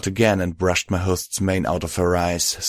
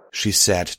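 plain reading voice

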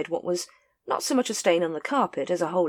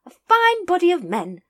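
high, loud grand lady; lady praising the watch, high and loud; hearty lady voice; 13 semitones higher, 6 dB louder than usual. A narrator's reading. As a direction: proclaim it like a proud patroness. Lady Ramkin to Vimes.

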